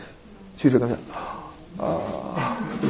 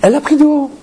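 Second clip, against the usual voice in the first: much higher, breaking into laughter; laughing aside; 12 semitones higher, 3 dB louder than usual. Real, not acted. Rabbi laughing at how the woman talked down to him. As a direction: laugh it out in disbelief. Laughing over her haughtiness.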